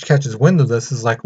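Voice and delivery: usual speaking voice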